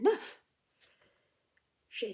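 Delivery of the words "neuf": "Neuf" ending in a clear F, higher and louder; F sound. Shown as a demonstration. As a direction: land hard on the F of "Neuf". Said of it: "Here's the F sound".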